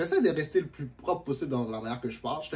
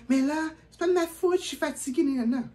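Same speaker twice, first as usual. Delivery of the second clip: high whiny excuse; mocking the girl; 11 semitones higher than usual. Storyteller imitating the girl's tired excuse. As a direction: whine it like her, pitched up.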